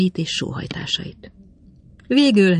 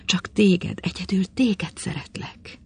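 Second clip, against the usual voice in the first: softer, tearful whispered confession; passionate whisper; 4 semitones higher, 3 dB quieter than usual. Acted. Madame declaring love to the cold young man, whispered. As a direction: breathe the words through tears.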